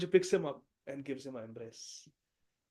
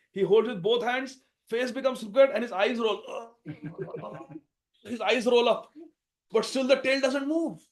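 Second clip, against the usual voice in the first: straining narration; 6 semitones higher, 7 dB louder than usual. A narrator's reading. Narrating Bhim straining hard.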